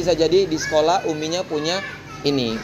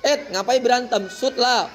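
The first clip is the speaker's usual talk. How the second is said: high-pitched teacher breaking up a squabble; lively raised-pitch intervention; mock chiding; 7 semitones higher than usual. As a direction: imitate telling kids off lightly. Acts breaking up children's squabble.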